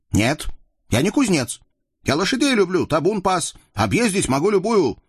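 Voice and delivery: high-pitched youthful boast; defensive young horseman. A narrator's reading. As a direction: answer back, then show off. Yusuf boasts of horse skills.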